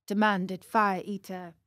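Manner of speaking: plain reading voice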